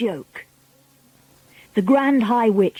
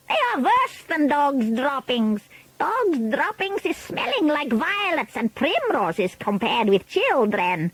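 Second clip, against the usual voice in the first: Grand High Witch; 6 semitones higher, 4 dB quieter than usual. A narrator's reading. Witch leader telling her audience children stink, disgusted villain voice. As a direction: draw out each nasty word up high.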